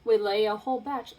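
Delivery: plain reading voice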